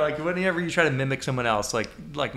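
normal talking voice

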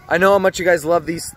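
usual speaking voice

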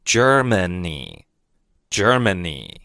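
Germany said very slowly and clearly twice; teacher's model word. Shown as a demonstration. Teacher to students, very slow careful model. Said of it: "德国叫Germany".

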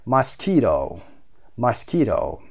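Mosquito with QUI stressed, repeated; second-syllable stress. Shown as a demonstration. Teacher showing learners English word stress, QUI stressed in Mosquito. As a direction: lean on QUI in each Mosquito. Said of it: "قسمت دوم هست".

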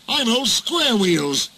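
high, slow indignant complaint; fuming. A narrator's reading. Henry reports his nickname to the controller, fuming. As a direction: fume over a hurtful nickname. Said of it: "fumed Henry".

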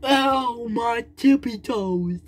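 high whiny ouch; mock ouch. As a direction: yelp about sore toes. Toy hurts feet.

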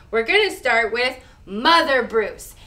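plain reading voice

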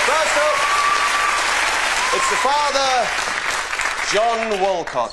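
slow booming game show introduction; announcer voice. Acted. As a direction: stretch the name out like a host. Game show host introducing family.

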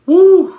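high, loud whoop of release; loud "woo". Real, not acted. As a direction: let out a sudden high woo. Overcome mid-sermon, lets it out.

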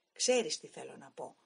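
angry stoker barking his question; shouted. Acted. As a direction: yell it at the driver over the wind. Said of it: "μου φώναξε".

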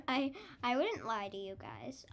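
ordinary delivery

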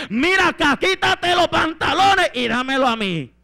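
loud, high bossy order; overbearing wife laying down the law; domineering Jezabel. Acted. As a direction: shout commands at a husband. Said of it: "ve a Jezabel".

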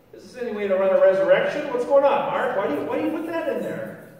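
loud, high, incredulous questioning; exasperated protest. Acted. Preacher challenges Mark over his ending, exasperated protest. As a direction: take the author to task, rising high.

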